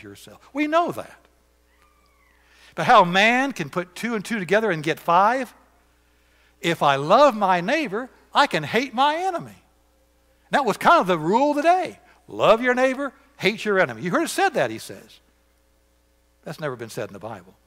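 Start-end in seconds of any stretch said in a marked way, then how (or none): 2.7-14.7 higher, louder preaching. Acted. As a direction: thunder it from the pulpit. Preacher at full force.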